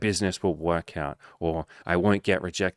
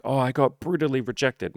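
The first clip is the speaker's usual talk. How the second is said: mock self-pity; 6 semitones higher than usual. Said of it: "the story that we tell about it".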